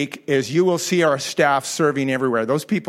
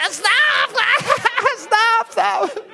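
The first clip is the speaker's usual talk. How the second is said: laughing stop; much slower than usual. Retold or imitated. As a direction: squeal it like a ticklish man. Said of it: "my guy's laughing".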